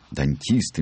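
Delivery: usual speaking voice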